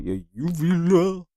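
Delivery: "uvula" forced out high and slow with a sore throat; "uvula" said slowly in a high strained voice. Real, not acted. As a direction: squeeze "uvula" out through a swollen throat.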